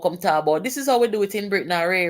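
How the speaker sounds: ordinary delivery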